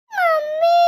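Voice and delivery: high, loud tearful wail of mummy; crying call. Acted. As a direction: whimper for mummy like a hurt child. Child crying to mother. (रोते हुए mummy पुकारना)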